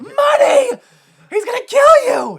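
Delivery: very high, loud mock panic; imitating a panicked screamer; freaking out. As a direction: yell it as a hammed-up frantic warning. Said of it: "and he freaks out because".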